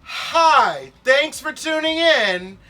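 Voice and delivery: high, loud, slow show host greeting; bright announcer welcoming viewers. Pretend. Mock TV host intro.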